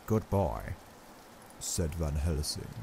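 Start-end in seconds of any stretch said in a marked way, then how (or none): 0.1-0.7 kindly, grave professor voice. Acted. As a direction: explain it gently and gravely. Van Helsing speaking.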